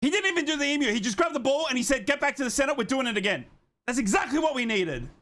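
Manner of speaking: high-pitched, still fired up from the goal; breathless-with-joy approval, pitch well up; excited. Real, not acted. Host praises scorer for hurrying the restart, excited.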